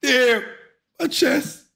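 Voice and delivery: high, loud, breathless laughter through the words; cracking up and barely getting words out; laughing hard. Real, not acted. Vlogger losing it over a clip, laughing hard.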